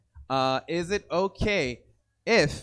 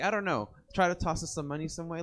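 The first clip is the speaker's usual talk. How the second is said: usual speaking voice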